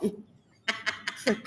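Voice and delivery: ordinary talk, nothing marked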